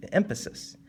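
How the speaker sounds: usual speaking voice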